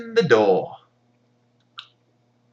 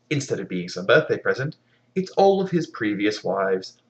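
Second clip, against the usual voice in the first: normal talking voice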